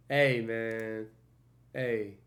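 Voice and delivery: ordinary talk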